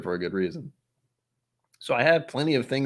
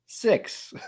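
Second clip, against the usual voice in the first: whispered six; 11 semitones higher than usual. Real, not acted. Counting bottles, breathy aside.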